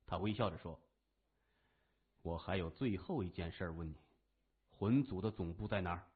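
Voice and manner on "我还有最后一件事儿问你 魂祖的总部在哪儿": lower, softer cool boss voice; quiet menace from a calm young boss; a lightly voiced composed gang leader; Xie Wendong, calm and smiling. A narrator's reading.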